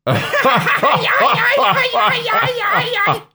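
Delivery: hammed-up maniacal laughing; evil villains' laugh. A narrator's reading. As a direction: cackle long and high like cartoon villains. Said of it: "They both laughed".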